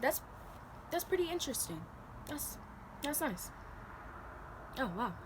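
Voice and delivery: high, soft, bored fake interest. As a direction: feign polite interest, tuning out. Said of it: "I'm just gonna be like".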